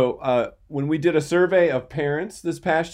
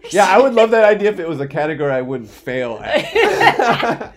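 laughing; 5 semitones higher, 9 dB louder than usual. Real, not acted. Joking about failing at a category.

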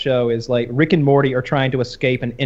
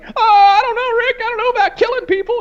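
much higher, whiny and fast, half laughing; imitating nervous Morty; 4 dB louder than usual. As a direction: whimper it like a scared teenager. Morty voiced in retold scene.

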